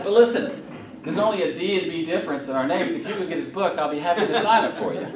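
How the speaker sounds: high-pitched, laughing through words. Real, not acted. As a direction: grin and chuckle as you talk. Laughing at his own joke.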